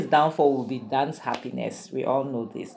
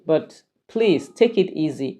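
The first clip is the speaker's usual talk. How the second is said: ordinary talk, nothing marked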